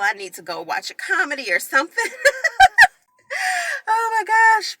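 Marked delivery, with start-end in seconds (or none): 2.1-4.8 high, louder laugh breaking the tension; tearful laugh then exclamation; relieved laugh. Real, not acted. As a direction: laugh through the tears. Laughing off the tears.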